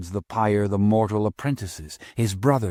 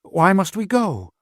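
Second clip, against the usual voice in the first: put-on frightened child; 10 semitones higher than usual. Frightened child asks master.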